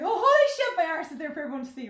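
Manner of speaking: high, loud embarrassed shriek; mock shriek. Mother losing her bikini, high shriek. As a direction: yelp it out in horror.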